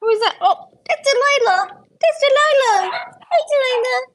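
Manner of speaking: very high squeaky pet voice; talking to a dog. Real, not acted. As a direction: squeal greetings at the puppies. Greeting dogs in baby talk.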